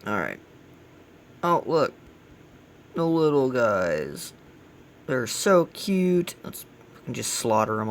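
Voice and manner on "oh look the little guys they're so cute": high, drawn-out cooing; playfully gushing at tiny cute creatures. Pretend. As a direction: coo slowly over something adorable. Cooing over cute little enemies.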